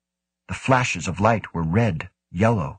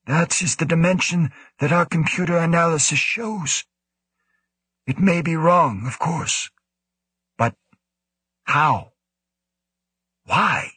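put-on raised, puzzled old director voice; pondering director; 9 semitones higher than usual. A narrator's reading. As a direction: wonder aloud in a higher voice. Woe muses over data.

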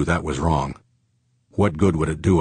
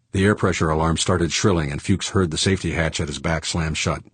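ordinary delivery

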